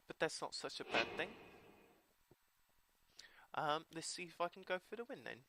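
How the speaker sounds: put-on British accent through the whole game commentary. Narrating his card game to viewers in a British accent. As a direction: play the match like a chatty British commentator. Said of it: "I hope you all enjoyed, uh, the British accent".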